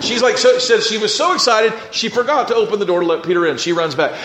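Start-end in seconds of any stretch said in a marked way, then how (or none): none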